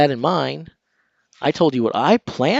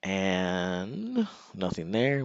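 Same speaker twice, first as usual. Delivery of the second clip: usual speaking voice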